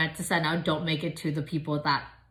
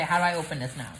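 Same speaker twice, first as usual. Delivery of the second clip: normal talking voice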